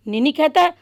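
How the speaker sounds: usual speaking voice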